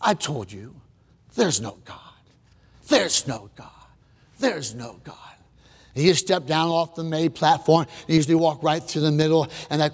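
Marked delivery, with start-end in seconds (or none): 0.0-5.1 low, soft smug sneer; low gloat. Retold or imitated. Preacher voicing Ingersoll gloating at the crowd, low. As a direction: gloat it quietly.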